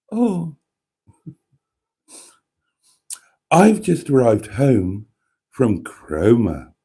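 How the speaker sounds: very slow, super sexy posh put-on voice; mock sultry and posh, lingering on every phrase; Emma impression. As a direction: draw it out like a seductive posh TV presenter, with long pauses. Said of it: "She puts on a super sexy posh voice".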